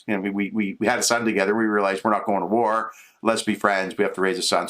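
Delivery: ordinary talk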